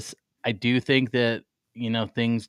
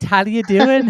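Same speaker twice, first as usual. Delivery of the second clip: high, loud mock British greeting; English accent; 13 semitones higher, 9 dB louder than usual. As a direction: put on a cheeky English accent. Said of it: "I'd be like".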